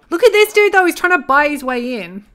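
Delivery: loud, high, amazed play-by-play; excited outburst. Real, not acted. Gamer watching a man bribe the bouncer, excited. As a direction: exclaim it loudly in delighted disbelief.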